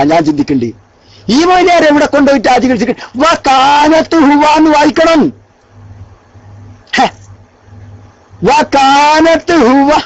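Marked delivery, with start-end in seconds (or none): 3.2-9.9 mocking repeat. Mocking opponent's reading.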